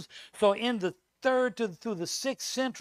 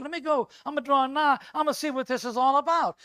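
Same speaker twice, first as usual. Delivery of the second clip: high, fast and loud, itching to go; eager Moses; 7 semitones higher, 7 dB louder, faster than usual. Acted. Moses eager to see the burning bush, raised and quick. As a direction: Moses hurrying toward the bush. Moses voiced in retold scene.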